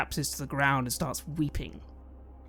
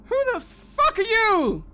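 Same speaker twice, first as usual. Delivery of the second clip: high, loud furious yell; angry shout; 7 dB louder than usual. Acted. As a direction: bark it at the stranger. Livio snapping at stranger.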